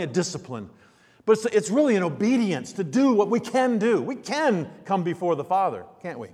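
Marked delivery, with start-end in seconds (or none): none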